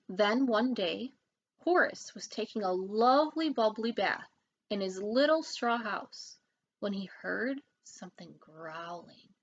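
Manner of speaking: ordinary delivery, nothing marked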